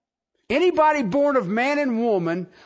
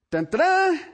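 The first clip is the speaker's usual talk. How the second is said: dramatic sting; 9 semitones higher than usual. Pretend.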